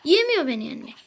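normal talking voice

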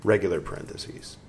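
slow, emphatic reading with punctuation spoken; measured, projected reading voice; book read-aloud. Acted. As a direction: read it out deliberately, phrase by phrase.

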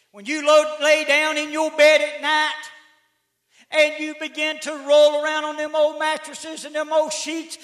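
high, loud preaching; rising preacher's holler; full-force preaching. Acted. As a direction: drive it home from the pulpit.

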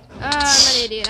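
annoyed at himself, loud; loud self-scolding. Real, not acted. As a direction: yell it after the fail. Fails jump, self-scolding.

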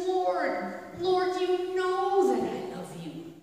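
Peter, stung by the third question, answering Jesus in frustration; frustrated plea. Retold or imitated. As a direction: plead it, fed up and hurt. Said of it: "Peter is so frustrated".

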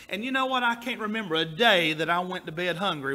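usual speaking voice

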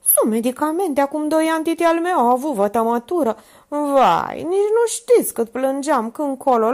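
high, loud homely reassurance; chatty comforting maid. A narrator's reading. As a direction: cheer her up with a family story. Minerva comforting with titi's story.

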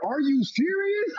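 high mock yell in the theater; shocked cry at the screen; retold yell. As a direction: yelp it out in disbelief. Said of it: "I literally yelled out in the theater".

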